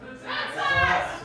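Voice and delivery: high crowd-fan cry of Red Sox; fan shouting back. Retold or imitated. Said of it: "is this a Yankees bar or a Red Sox bar".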